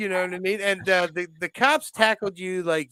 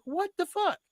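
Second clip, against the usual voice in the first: much higher, incredulous; mock disbelief; 5 dB quieter than usual. Friend left stranded after the arrest, high mock disbelief. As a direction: squeak out disbelief. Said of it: "I'm like".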